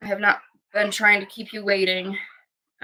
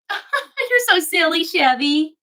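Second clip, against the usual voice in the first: laughing; 6 semitones higher, 4 dB louder, slower than usual. Real, not acted. Laughing at the dog.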